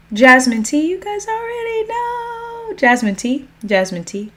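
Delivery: high, playful lilt with rising ends; playful announcement. Real, not acted. Host showing off a favorite tea to the chat, playful.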